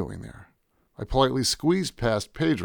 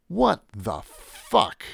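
very slow, higher, word by word; stunned disbelief; 5 semitones higher, much slower than usual. Acted. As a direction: stretch each word out in disbelief. Stunned as paintings burn.

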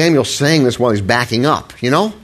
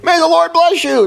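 high, bright mock-cheery hypocrite greeting; cheerful greeting. Saul greeting the prophet with fake cheer. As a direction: greet him with fake sweetness. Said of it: "you hypocrite".

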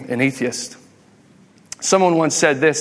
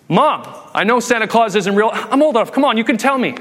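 higher-pitched kid coaxing, acted out; pleading child; 5 semitones higher than usual. Kid asks mom for the truth, pleading. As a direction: plead like a kid who wants to be let in on it.

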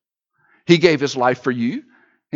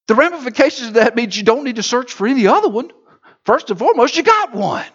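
normal talking voice

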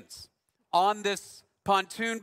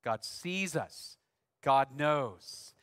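reassuring repeat; 5 semitones lower, much slower than usual. Retold or imitated. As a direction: hush a frightened child gently. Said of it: "she'd say it again".

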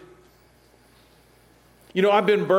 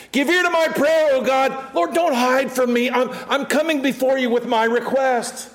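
psalm read; 8 semitones higher than usual. Acted. Reading psalm prayer.

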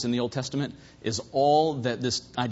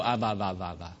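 soft, low babbling like an infant; lightly imitated baby calling its daddy; baby babble; 9 semitones lower, 5 dB quieter, faster than usual. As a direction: coo it the way a baby first says the word. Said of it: "a little baby who go".

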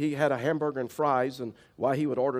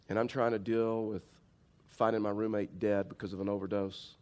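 mild teary retelling; 6 semitones lower, 6 dB quieter than usual. Retold or imitated. Waitress tells the pastor about her roommate's death, softly and brokenly. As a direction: let it come out heavy and worn down, understated. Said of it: "broke down and started crying".

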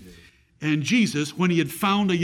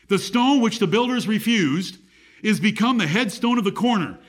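scripture reading; 4 semitones higher than usual. Acted. Reads scripture aloud.

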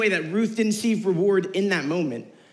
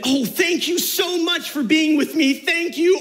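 high, louder gushing thanks; mock grateful Naomi; 9 semitones higher, 4 dB louder than usual. Youth pastor mocks the thanks Naomi never gave, high and gushing. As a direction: gush over the top as a grateful friend. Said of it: "no, that's not what it says".

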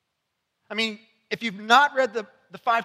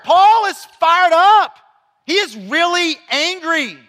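slow, loud and high, stretching each word; fired-up preaching; 6 semitones higher, 7 dB louder, slower than usual. Real, not acted. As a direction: proclaim it with full force. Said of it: "preaching emphasis".

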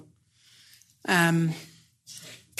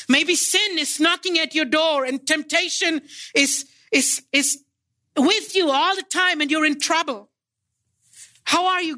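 raised and urgent, pitch climbing high; impassioned preaching; 7 semitones higher than usual. Real, not acted. Preacher warns the congregation of temptation, urgent and raised. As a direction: press the warning on the congregation, rising.

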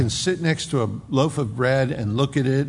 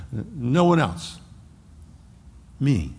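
usual speaking voice

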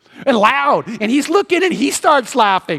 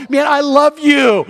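high, loud, drawn-out affection; imitating an overjoyed boss; delighted outburst; 6 semitones higher, 6 dB louder, slower than usual. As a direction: exclaim it with huge delight. Laughing CEO's delighted outburst, retold.

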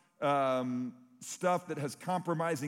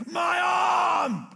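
mock agonized scream, much higher and louder; 9 dB louder than usual. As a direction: shriek it like a man who just lost his arm. Man's arm cut off.

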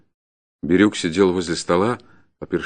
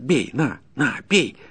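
fierce, high-pitched dare; ferocious voice; 5 semitones higher, slower than usual. Acted. Peasant goads the forester to hit him, ferocious. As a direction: offer yourself savagely for the blow. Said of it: "подхватил мужик свирепым голосом".

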